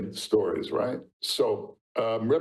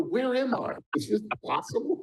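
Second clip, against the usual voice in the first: amazed disbelief; 9 semitones higher than usual. Retold or imitated. Inner amazement in the deli.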